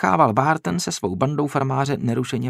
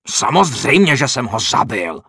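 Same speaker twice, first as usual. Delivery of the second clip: loud, furious cry; loud angry shout; indignant shout; 6 dB louder than usual. A narrator's reading. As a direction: yell to save face. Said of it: "vykřikl".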